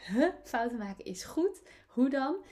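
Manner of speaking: baffled listener's questions, rising high and loud at the ends; puzzled disbelief. Acted. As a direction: question it as if you can't believe it. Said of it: "dat je dit nu hoort en denkt".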